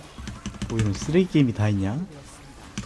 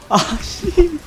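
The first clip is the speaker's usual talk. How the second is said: high frustrated curse; much slower than usual. Real, not acted.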